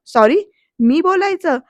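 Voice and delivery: rising, disbelieving questions; incredulous. Retold or imitated. Proud father told to apologise.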